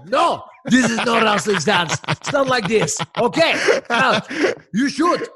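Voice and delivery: put-on high, bossy Russian accent; a hint of a Russian accent, commanding; Russian coach. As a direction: order him around like the Russian coach. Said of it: "heavy Russian accent".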